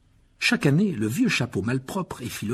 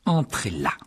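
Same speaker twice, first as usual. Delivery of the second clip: voix de Rogue qui ordonne, froide; ordre sec; slower than usual. A narrator's reading. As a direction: ordonner d'un ton sec. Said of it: "ordonna-t-il". (ordre glacial de Rogue)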